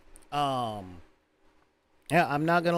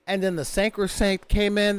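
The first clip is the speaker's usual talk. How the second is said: usual speaking voice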